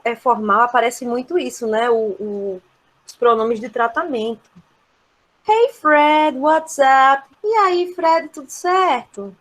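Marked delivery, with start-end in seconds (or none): 5.5-9.3 higher, louder chummy hello; casual greeting. Shown as a demonstration. Teacher acting out greeting Fred informally, casual and raised. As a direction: call out to a pal informally. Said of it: "você vê que é mais descontraído".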